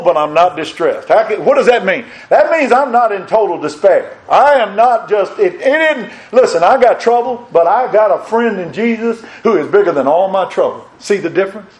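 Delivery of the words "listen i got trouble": ordinary talk, nothing marked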